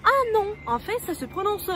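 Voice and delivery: se récrier d'une voix plus aiguë; correction de prof. Acted. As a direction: reprendre l'élève, «non» haut perché. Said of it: "il y a Diana qui dit". (correction vive et aiguë)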